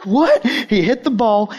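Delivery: startled reaction to the bounce; surprised. Real, not acted. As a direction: blurt out disbelief. Ball bounced into the water.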